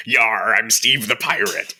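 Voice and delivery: loud mock pirate growl-out; hammed-up pirate. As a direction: announce yourself as a cartoon pirate. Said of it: "just turns to them and goes".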